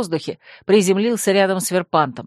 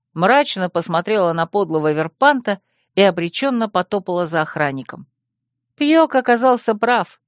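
ordinary delivery

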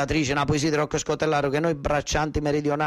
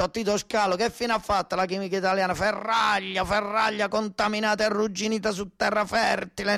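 raised, heated denunciation; angry rant; 7 semitones higher than usual. Real, not acted. Farmer denouncing the government's chemical industry, heated and raised. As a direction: rail against the chemical industry.

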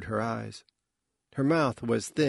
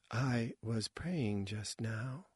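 hushed, grave; 10 dB quieter, much slower than usual. A narrator's reading. Sister speaks gravely.

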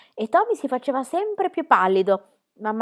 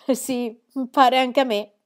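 satisfied; 4 semitones higher, 5 dB louder, slower than usual. A narrator's reading.

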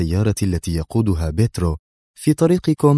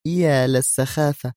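slow, higher-pitched dismissal; pouting disdain; 6 semitones higher, slower than usual. A narrator's reading. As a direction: dismiss it with pushed-out lips. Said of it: "مطت نادية شفتيها قائلة". (باستخفاف)

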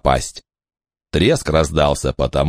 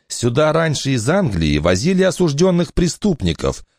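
higher, lively woman's voice; mother's voice; 10 semitones higher than usual. Acted. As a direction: speak up bright as the mother. Eleanor continues.

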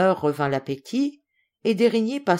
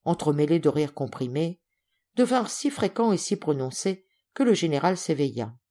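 ordinary delivery

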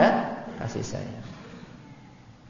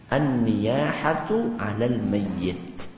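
each syllable drawn out slowly; slow, careful Arabic phrase; slow recitation; much slower than usual. Shown as a demonstration. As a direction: spell it out slowly for the congregation. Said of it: "itu artinya adalah".

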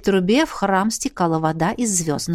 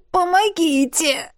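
high, drawn-out plea for help; groaned; 9 semitones higher, slower than usual. A narrator's reading. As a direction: moan it out, stuck and straining. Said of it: "простонал он". (жалобный стон о помощи)

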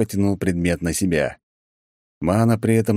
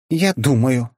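mildly irritated; 7 semitones higher, 4 dB louder, slower than usual. A narrator's reading. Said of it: "с лёгким раздражением ответила Фуори".

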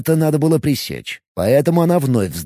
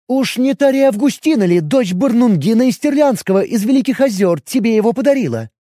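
higher, jealous elf woman; jealous elf questioning her man; 9 semitones higher, 3 dB louder than usual. Acted. Elf voiced in dialogue.

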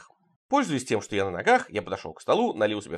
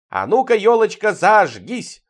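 loud, high, drawn-out command; festive shout; 8 semitones higher, 6 dB louder, slower than usual. A narrator's reading. Man yells at the New Year tree to light up, shouting. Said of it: "он закричал".

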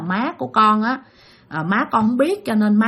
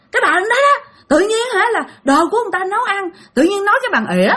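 accusing possessed father; 10 semitones higher, faster than usual. Retold or imitated.

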